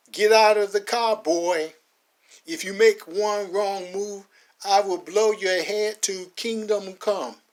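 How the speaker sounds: slow, loud warning; officer dragging Max from the car; menacing threat. Acted. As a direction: threaten him coldly.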